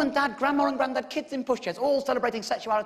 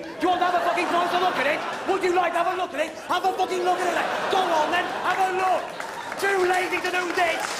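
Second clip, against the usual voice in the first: loud, higher, fast taunting shout; shouting challenge; 5 semitones higher, 3 dB louder than usual. Acted. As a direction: yell at the heckler to look.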